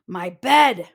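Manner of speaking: Papa Bear finding his bed used; sputtering. Acted. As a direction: splutter out the words. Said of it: "Papa Bear sputtered".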